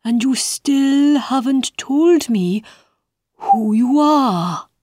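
high, slow, drawn-out caterpillar. Acted. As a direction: stretch each word like a lazy bug. Caterpillar voiced by the reader.

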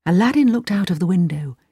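plain reading voice

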